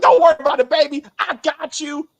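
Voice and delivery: hammed-up Jax promising to take care of her; mock Jax bravado. Mocking Jax's bravado.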